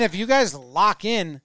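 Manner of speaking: normal talking voice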